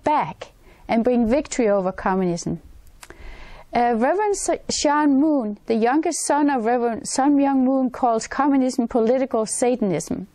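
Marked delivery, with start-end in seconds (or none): none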